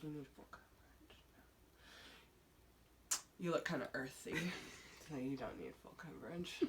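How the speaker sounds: low, quiet, dead-serious verdict; deadpan makeup expert. Retold or imitated. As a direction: pronounce it like a grave sommelier, almost breathless at the end. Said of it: "'Cause he was so serious".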